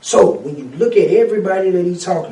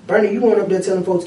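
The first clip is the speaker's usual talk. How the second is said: normal talking voice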